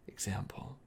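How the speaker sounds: sly whispered hint. Acted. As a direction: breathe it like a secret. Lover hinting at a threat.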